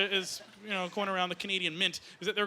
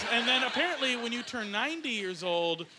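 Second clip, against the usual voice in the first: ordinary talk, nothing marked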